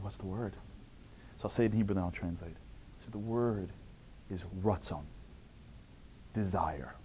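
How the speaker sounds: soft, hushed reveal ending in a whisper. Retold or imitated. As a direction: lean in and reveal quietly. Revealing the one word.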